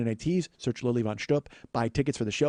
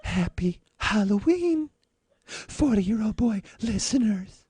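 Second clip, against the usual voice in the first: high, slow breathy starlet; Marilyn voice; 7 semitones higher, slower than usual. Acted. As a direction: coo it like a pin-up. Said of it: "Marilyn Monroe's voice".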